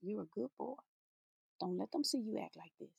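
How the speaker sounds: put-on calm the child quietly; softly calming a boy throwing a tantrum. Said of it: "calmly said".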